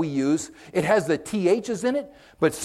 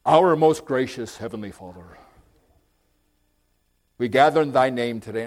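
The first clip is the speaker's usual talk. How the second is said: formal preacher prayer; 5 semitones lower than usual. Retold or imitated. Lightly mocking formal preachers at public prayer, solemn. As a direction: mimic a stiff old-fashioned preacher. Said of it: "some of these preachers".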